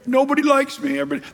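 whiny complaint. Retold or imitated. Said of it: "Jacob saying".

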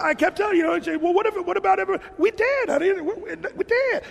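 high, laughing imitation of a carefree driver; high, amused voice of a man at peace; mimicking the driver. Pastor imitating a YouTube driver telling his wife.

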